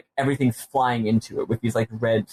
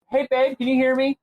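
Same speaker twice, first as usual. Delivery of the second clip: high, raised voice to someone away; calling out; 12 semitones higher than usual. Real, not acted. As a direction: call out across the room. Calling out to partner off-mic.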